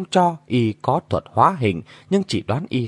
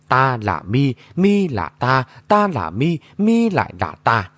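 plain reading voice

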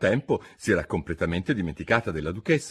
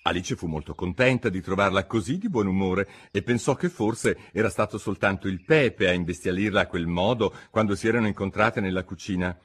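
plain reading voice

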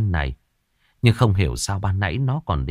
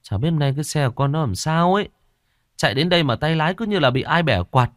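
quick, higher sheepish excuses; sheepish son; 7 semitones higher than usual. Acted. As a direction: rattle off excuses, scratching your head. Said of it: "anh gãi đầu".

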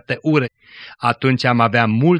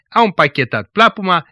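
ordinary delivery, nothing marked

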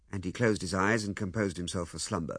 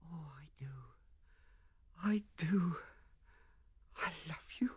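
tender whisper; 7 semitones higher, 8 dB quieter than usual. Acted. Ethel repeats her love to Lewisham, tenderly whispering. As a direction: whisper it with long pauses between.

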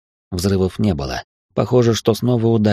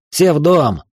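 higher, raised command voice; barked order; 7 semitones higher, 4 dB louder, slower than usual. A narrator's reading. Ordering everyone indoors under fire, barked command. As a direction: shout them into shelter. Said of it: "рявкнул я".

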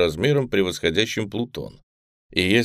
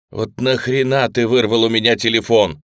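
louder, higher indignant outburst; 4 semitones higher, 6 dB louder than usual. Acted. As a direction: snap back in outrage. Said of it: "возмущённо сказал Николай". (возмущённо)

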